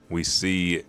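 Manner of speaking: normal talking voice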